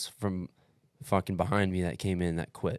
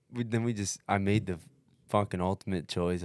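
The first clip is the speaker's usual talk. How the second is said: ordinary delivery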